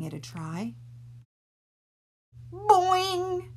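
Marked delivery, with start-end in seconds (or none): 2.5-3.5 high loud cartoon boing; springy boing. Reader making the hair spring back up. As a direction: bounce the sound like a spring.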